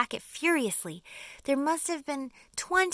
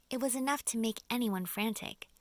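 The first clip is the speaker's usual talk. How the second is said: ordinary delivery, nothing marked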